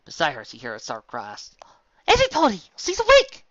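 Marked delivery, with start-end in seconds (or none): 2.1-3.5 high, loud joyful shout; excited yell. A narrator's reading. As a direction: call the whole house up the stairs. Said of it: "yelled from her chair".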